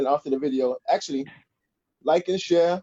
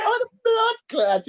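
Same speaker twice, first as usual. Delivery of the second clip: mock exclamation; slower than usual. Listener mimics Jamaican exclamation.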